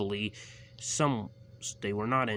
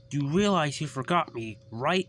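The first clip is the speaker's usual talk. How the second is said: scolding driver; 7 semitones higher, 6 dB louder than usual. A narrator's reading.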